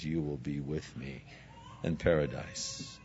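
tender promise. Retold or imitated. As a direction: promise it gently to a dying man. Jesus's promise to the thief.

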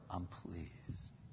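very soft, low divine reply; God's quiet reassurance. Acted. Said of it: "But he goes".

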